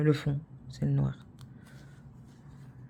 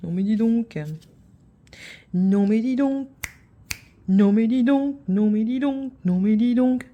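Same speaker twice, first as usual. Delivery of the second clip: builds from mildly indignant to higher and louder; mock scolding; 6 semitones higher, 6 dB louder than usual. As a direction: escalate the mock outrage on each repeat. Repeated mock scolding, escalating.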